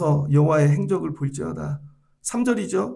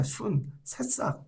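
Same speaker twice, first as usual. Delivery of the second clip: soft slow tenderness; 2 semitones higher, 10 dB quieter, much slower than usual. Real, not acted. Tender image of a sprout.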